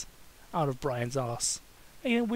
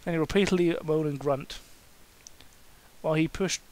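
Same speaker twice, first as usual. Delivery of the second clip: ordinary delivery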